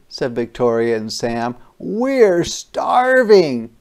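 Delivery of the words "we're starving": put-on child's voice. Kids answering Ma about cookies.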